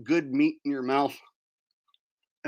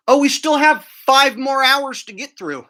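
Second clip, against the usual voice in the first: loud exasperated; 5 semitones higher, 9 dB louder than usual. Real, not acted.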